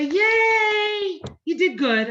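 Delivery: high, loud burst of praise; cheering parent. Retold or imitated. Parent cheering a child who did well, excited. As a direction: cheer the kid on like a proud parent. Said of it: "He wants us to say".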